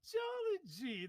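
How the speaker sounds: very high, slow sing-song gee; hammed-up clown delight; jolly clown exclamation. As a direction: stretch it out like a giddy clown.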